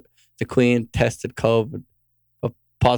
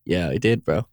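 mock self-reply; 6 semitones lower than usual. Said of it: "sit there and be like".

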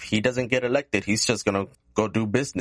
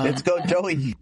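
high mock cheer; 9 semitones higher than usual. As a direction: cheer for Joey in fun. Cheering Biden mockingly.

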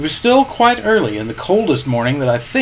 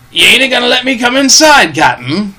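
high, loud, aggrieved plea; Long John Silver complaining; 9 semitones higher, 5 dB louder than usual. A narrator's reading. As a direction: protest loudly to the captain. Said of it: "complained Long John".

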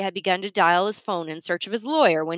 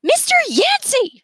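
much higher, louder sharp call; shout; 6 dB louder than usual. A narrator's reading. Miss Sparks shouting to get Yancey's attention. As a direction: call him to order across the crowd. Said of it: "she shouted".

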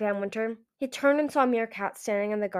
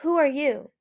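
raised, louder challenge; demanding; 6 semitones higher, 5 dB louder than usual. A narrator's reading. Skywing confronts the Ice Wing stranger, demanding. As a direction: demand to know who it is. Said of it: "the Skywing demanded".

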